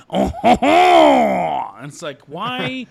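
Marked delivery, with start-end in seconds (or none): 0.1-1.8 loud, very slow, high "Oh, ho, ho!"; hammed-up French exclamation. Mocking dub actors, a loud, drawn-out French flourish. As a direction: belt it out like a pompous Frenchman. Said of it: "that goes".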